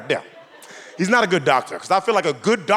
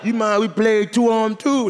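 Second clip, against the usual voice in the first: tall dudes asking; 6 semitones higher than usual. Retold or imitated. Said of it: "And they were like".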